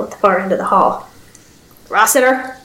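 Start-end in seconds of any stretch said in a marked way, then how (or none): 1.9-2.6 put-on old man croak. A narrator's reading. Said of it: "a male voice croaked".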